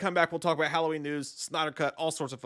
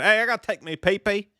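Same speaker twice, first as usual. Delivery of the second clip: higher-pitched put-on Southern accent; 7 semitones higher, 3 dB louder than usual. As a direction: play it as a Southern good old boy. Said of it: "under the Southern accent".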